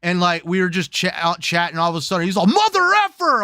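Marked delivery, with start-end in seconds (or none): 2.5-3.4 imitated rage yelp, pitch leaping up; mock outburst. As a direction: blurt it out high like a frustrated gamer. Retelling a gamer's sudden outburst.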